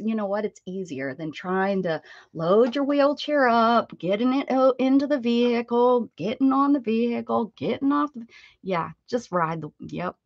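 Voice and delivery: ordinary delivery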